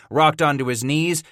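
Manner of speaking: plain reading voice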